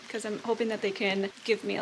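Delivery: usual speaking voice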